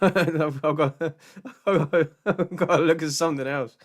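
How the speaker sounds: talking through a laugh. Real, not acted. Deciding to quit the videos, laughing. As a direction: laugh while saying it.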